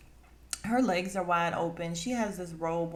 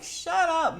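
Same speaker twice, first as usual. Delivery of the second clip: higher, louder snap of exasperation; higher, louder exasperated shut up; exasperated shout; 11 semitones higher, 5 dB louder than usual. Retold or imitated. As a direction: tell her to shut up. Vlogger telling Mia to stop.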